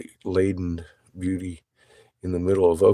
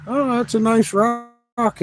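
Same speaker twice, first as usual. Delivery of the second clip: high, slow, sing-song mock of the professor; 3 dB louder, slower than usual. Mocking the visiting professor, high and slow. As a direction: imitate the professor brushing the rock off. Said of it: "started talking about".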